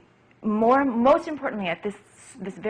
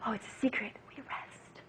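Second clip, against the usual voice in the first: whisper; 2 semitones higher, 15 dB quieter than usual. Shown as a demonstration. Teacher whispering the rest to a child. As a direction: drop to a secret whisper. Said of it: "You can whisper on the rest".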